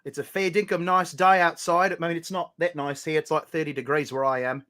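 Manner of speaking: chatty mock-Australian small talk; put-on Australian accent. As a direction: report the weather like a playful Aussie. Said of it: "despite the accent".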